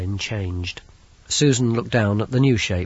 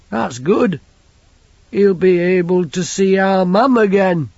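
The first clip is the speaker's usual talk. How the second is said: simple lad voice; 8 semitones higher, 7 dB louder than usual. Acted. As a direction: voice him like a big simple boy. Banjo's voice.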